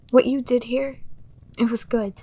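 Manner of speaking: ordinary talk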